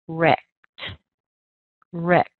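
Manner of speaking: "rect" with a clear eh and crisp ending; stressed syllable model. Shown as a demonstration. Teacher isolating the stressed syllable for learners, crisp. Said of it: "let's look at the second syllable".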